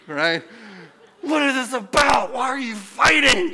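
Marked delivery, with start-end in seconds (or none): 1.2-3.5 higher, louder scolding father; angry dad. Retold or imitated. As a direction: burst in and demand answers, annoyed. Dad bursts in on quarrel.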